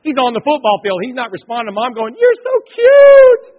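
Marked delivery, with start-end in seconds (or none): 2.2-3.5 very high, drawn-out loud scream; screaming mom. Retold or imitated. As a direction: scream it slowly like a gushing mom in the stands. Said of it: "This mom sitting behind me is screaming".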